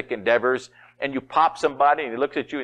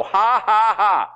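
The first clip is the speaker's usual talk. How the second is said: loud, high, deliberate "ha, ha, ha"; forced laugh; 11 semitones higher, 7 dB louder, slower than usual. Shown as a demonstration. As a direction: push out each laugh on purpose. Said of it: "If you have to go".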